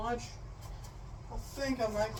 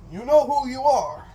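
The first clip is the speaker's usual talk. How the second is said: mock warning; 4 semitones higher, 10 dB louder than usual. Teasing friends in comments.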